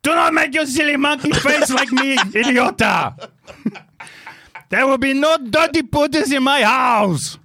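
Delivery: high, ranting mock Austrian accent; hammed-up furious wife sounding like an Arnold impression; Schwarzenegger accent. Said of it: "Arnold said".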